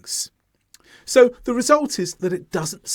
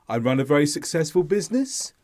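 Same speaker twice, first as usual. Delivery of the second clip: quick statement turned question, BUSINESS going up; rising intonation; faster than usual. Shown as a demonstration. Teacher demonstrating uncertainty to learners, rising on BUSINESS. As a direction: let BUSINESS lift at the end, unsure. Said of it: "I'm unsure about what I'm saying".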